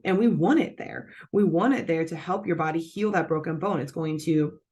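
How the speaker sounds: ordinary delivery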